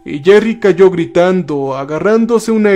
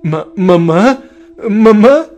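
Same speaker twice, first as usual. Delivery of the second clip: stammered, questioning call, rising at the end; emotional stammer; 4 semitones higher, slower than usual. A narrator's reading. Son hears his mother's voice in the cave, emotional stammer. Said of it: "balbució emocionado".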